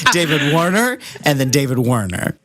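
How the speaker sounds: normal talking voice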